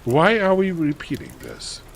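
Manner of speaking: plain reading voice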